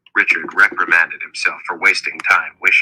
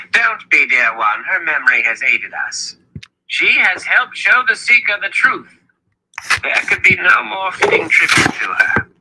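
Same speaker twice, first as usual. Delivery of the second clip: high-pitched, consoling elder; Zed's kindly reassurance; 11 semitones higher than usual. A narrator's reading. Zed forgiving Kalen over his wife's tree, kindly reassurance.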